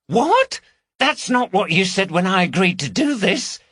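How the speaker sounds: sudden high shocked question; shocked and indignant; outraged disbelief. Acted. Woman learns her partner lied about the plan, high outraged cry.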